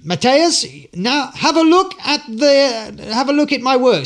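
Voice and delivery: high, loud, slow showman voice; hammed-up showman calling to the blindfolded volunteer; showman announcing. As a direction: play the grand stage performer. Voicing himself to volunteer on stage.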